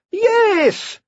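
a very high, loud, thrilled yes; delighted yes. Acted. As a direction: squeal with delight. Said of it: "said Nicolaus delightedly".

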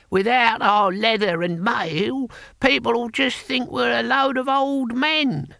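put-on high, slow, stretched old voice; complaining old man. A narrator's reading. Boy Willie objecting to losing leather and mail, high complaining old man. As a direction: whine it out high and slowly.

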